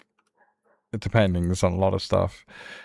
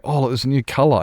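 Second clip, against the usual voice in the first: mock excited; 6 semitones higher, 4 dB louder, faster than usual. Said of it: "he kept saying".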